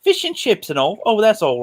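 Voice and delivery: put-on British with lilting pitch; British accent. Shown as a demonstration. Showing friends how to get into it, British accent. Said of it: "say British words to kinda get into the accent".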